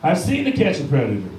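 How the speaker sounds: loud indignant rant. Retold or imitated. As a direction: tell her off, offended. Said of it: "And then I got mad".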